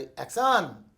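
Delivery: called out Action!; loud director's call of "action!". Retold or imitated. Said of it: "तो उनका action ऐसा ही होता था".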